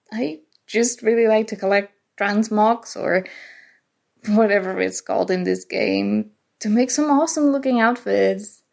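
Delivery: ordinary talk, nothing marked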